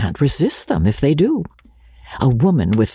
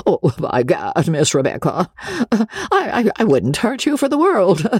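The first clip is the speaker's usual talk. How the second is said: stammering gallant, climbing high in pitch; flustered Joseph; 7 semitones higher than usual. A narrator's reading. Shy man assures her he'd never hurt her, flustered.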